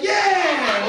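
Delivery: very loud, high-pitched cheer; triumphant yell. Retold or imitated. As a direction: yell it out in gleeful celebration. Exultant yell, retold.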